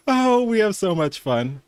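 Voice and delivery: ordinary talk, nothing marked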